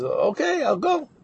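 high-pitched, going-along okay; hesitant agreement. Retold or imitated. Collector agreeing to a neighbor's odd favor, hesitant.

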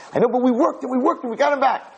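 very high, urgent imitation of a frantic nurse; screaming nurse. Nurse calls the doctor back into surgery, frantic high cries. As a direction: cry out breathlessly urgent, pitch way up, no real scream. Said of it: "this nurse comes screaming out".

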